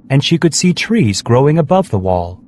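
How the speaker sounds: ordinary delivery, nothing marked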